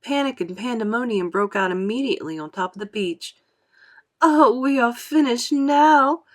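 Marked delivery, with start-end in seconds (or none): 4.2-6.2 higher, louder, slow despair; despairing cry. A narrator's reading. Miss Spider despairing as sharks attack. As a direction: wail that all is lost. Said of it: "cried Miss Spider".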